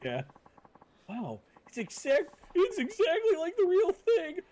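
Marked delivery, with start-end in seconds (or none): 1.1-4.4 put-on much higher, bright young boyfriend voice; high eager boyfriend. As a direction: play the lovesick boyfriend, pitched up.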